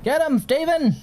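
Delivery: high, loud cheering on; excited cheer. Real, not acted. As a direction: yell encouragement with glee. Cheering on a robot.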